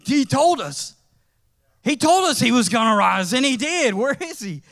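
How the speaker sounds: excited, rising disbelief; realization. Retold or imitated. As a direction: voice the disciples' sudden understanding. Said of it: "They're like".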